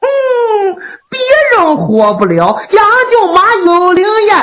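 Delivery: very high, teasing sing-song; high-pitched, playful mystification; wily official insisting. A narrator's reading. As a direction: insist on a miracle with mock certainty. Kou Zhun insists she will revive.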